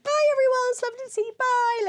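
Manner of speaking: high, loud singsong farewell; chirpy bye to everyone as she flees; sing-song goodbye; mock cheery goodbye. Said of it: "I was like".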